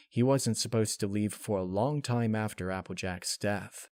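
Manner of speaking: usual speaking voice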